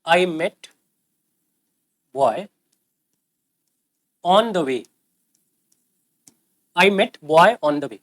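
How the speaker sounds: very slow, word by word example sentence; teacher slowly reading out an example sentence; slow dictation. Shown as a demonstration. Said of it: "जैसे मान लीजिए अगर आपने कहा".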